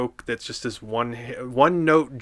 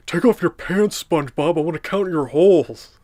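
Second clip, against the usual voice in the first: Patrick; 5 semitones higher, 5 dB louder than usual. Acted. Patrick line voiced.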